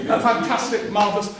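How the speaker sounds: mock delight. Cameron voiced again, delighted.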